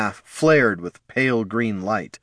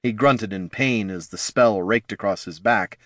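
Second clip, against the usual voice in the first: ordinary delivery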